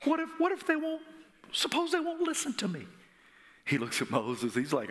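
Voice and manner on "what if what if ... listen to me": high, timid pleading doubter; Moses' worried voice. Retold or imitated. Preacher voicing a fearful Moses, high and timid. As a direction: squeak out the excuse like a scared man. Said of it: "Moses is like".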